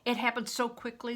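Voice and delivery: plain reading voice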